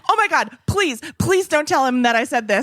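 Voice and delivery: high, frantic begging; mock panicked pleading. Caught comedian begging the assistant in high panic. Comedian begs her not to tell.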